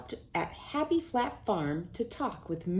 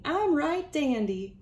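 giggly granny; 7 semitones higher than usual. Acted.

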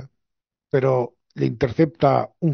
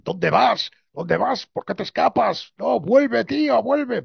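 rising, pleading calls that climb much higher; calling after him; 9 semitones higher, slower than usual. Retold or imitated. The two clowns calling Arthur back as he escapes, rising pleading calls. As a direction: shout after him as he runs away, voice climbing. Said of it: "los otros dos que le dicen".

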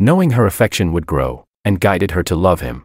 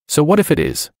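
higher-pitched, lightly smiling retort; a touch of smiling nonchalance; smiling reply; 8 semitones higher than usual. A narrator's reading. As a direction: answer the challenge with a smile. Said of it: "I replied with a smile".

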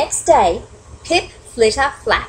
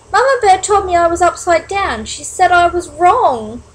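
confused protest; 8 semitones higher, 4 dB louder than usual. Acted. Confused young bat protests.

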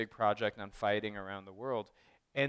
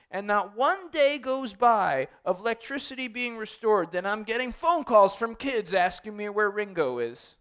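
much higher, louder, pleading voice; imitating an upset stranger; 10 semitones higher, 7 dB louder than usual. The crying man's odd confession.

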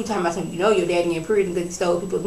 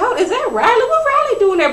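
much higher, rising surprise; surprised double take; 4 dB louder than usual. Retold or imitated. Host mistaking Candy for her daughter, high and surprised. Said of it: "I had to look, I had to rewind".

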